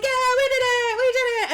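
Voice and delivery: high, loud hammed-up cheer; mock celebration. Team who froze the monster, mock triumphant cheer.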